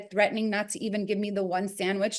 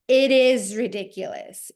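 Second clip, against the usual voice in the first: smug man; 4 dB louder than usual. Retold or imitated. Man doubling down.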